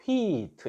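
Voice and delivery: "wheat" with W and H blended into a long hwee, then a light t; "wheat" modelled slowly as hweet; blended pronunciation demo. Shown as a demonstration. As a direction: glide through "wheat" as one stretched syllable. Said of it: "이렇게 읽었어요".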